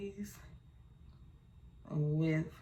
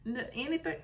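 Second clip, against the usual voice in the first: exasperated pleading; 9 semitones higher than usual. Retold or imitated. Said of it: "I'm like".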